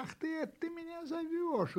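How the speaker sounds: long, high "ah" of realisation; high kitten voice, amazed; surprised. A narrator's reading. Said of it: "удивился котёнок". (удивлённый котёнок)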